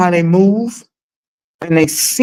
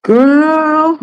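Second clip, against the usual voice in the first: high exclaim; 10 semitones higher, 3 dB louder than usual. Real, not acted. Vlogger tells her viewers, loud and high. Relief after gas.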